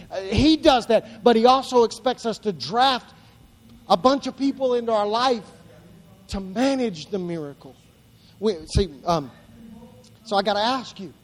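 normal talking voice

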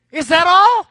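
high, blaring mock clerk; hammed-up pestering question; impatient order-taker. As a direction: blare it out, rising at the end. Drive-thru lady interrupts again.

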